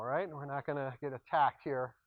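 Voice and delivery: ordinary talk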